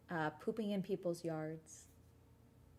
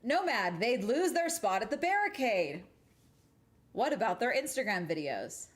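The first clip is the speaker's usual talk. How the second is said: raised indignant voice; 3 semitones higher, 4 dB louder than usual. Real, not acted. Mock outrage about fans' spots.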